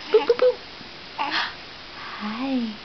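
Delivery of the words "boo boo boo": high, loud playful boos; peekaboo, up high and bright; squeaky boo. Real, not acted. Playing peekaboo with baby.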